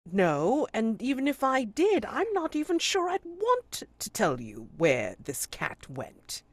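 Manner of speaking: high, loud scornful refusal; scornful constable. Acted. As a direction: shut down the suspect.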